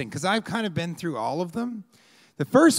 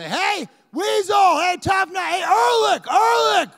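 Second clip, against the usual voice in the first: high, drawn-out fan holler; hammed-up high-pitched calling; yelling out; 5 dB louder than usual. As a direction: shout names across the street to get attention. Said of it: "they yell out".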